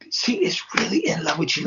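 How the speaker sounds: whispered gushing imitation; soft, airy retold confession; confiding whisper. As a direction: whisper it like a thrilling secret. Imitates friend delivering secret message.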